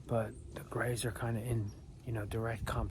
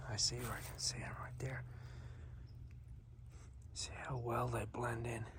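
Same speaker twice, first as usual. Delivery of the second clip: whispering; 10 dB quieter than usual. Real, not acted. Hunter whispering, squirrel spotted.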